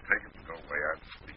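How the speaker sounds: soft, drowsy and low; sleepy. Acted. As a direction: mumble it half asleep. Woken ambassador, sleepy.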